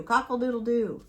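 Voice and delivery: lightly voiced mock rooster crow; casual rooster call. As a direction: give a half-hearted crow. Rooster imitation again.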